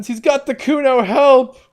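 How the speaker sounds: high, frantic screams for help; shrill panicked kid yelling help; child shouting for help. Acted. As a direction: yell it out the window like a panicked kid. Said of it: "The boy joins in".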